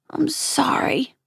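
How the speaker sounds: sniffling, hushed apology; tearful whisper. A narrator's reading. Boy apologizing to Mom while crying, tearful whisper. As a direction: say sorry through sniffles, barely voiced. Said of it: "I said between sniffles".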